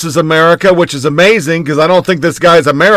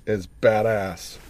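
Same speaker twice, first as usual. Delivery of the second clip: a low, hushed badass said almost to himself; quiet aside; 6 semitones lower, 11 dB quieter, slower than usual. Real, not acted. Host alone at the mic, mutters his approval of the drummer, softly. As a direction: murmur badass under the breath, much softer.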